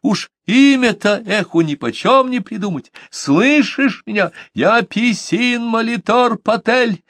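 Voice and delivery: high, slow desperate yell. A narrator's reading. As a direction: shout your name at the elements. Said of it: "проорал я в лицо стихиям". (высокий медленный крик)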